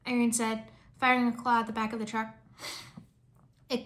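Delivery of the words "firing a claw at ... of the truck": ordinary delivery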